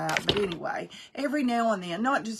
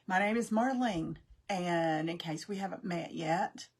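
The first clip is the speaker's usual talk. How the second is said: ordinary talk, nothing marked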